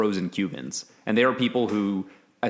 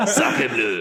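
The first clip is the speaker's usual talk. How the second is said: much higher, hammed-up French accent; mock French accent; 14 semitones higher, 4 dB louder than usual. Joking about the exiled French, in a hammed-up French accent. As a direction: toss it off in a jokey French accent. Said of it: "that's a way better French accent".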